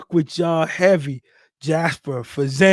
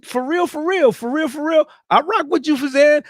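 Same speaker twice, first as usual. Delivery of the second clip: higher, lively praise; excited shout-out; 7 semitones higher than usual. Real, not acted. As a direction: hype the viewer up. Shouting out a viewer.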